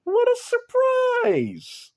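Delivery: high, loud sarcastic yell of a villain; loud, high gloating villain; mocking shout. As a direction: shout the fake surprise way up high. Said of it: "he's like".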